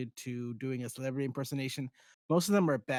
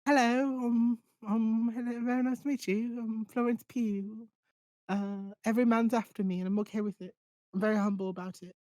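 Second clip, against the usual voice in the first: high, put-on British accent impression; bad British accent, pitched high; British accent Florence Pugh; 9 semitones higher than usual. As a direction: play a posh-sounding British starlet, pitched up. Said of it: "She's British. She's from Oxford".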